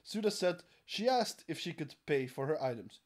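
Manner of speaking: normal talking voice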